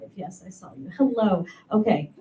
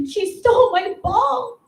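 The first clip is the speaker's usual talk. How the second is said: put-on high, slow whiny kid sister; little sister tattling; 13 semitones higher, slower than usual. Little sister complains to dad, high slow whine. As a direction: whine it like a wronged little girl. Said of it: "my sister's like".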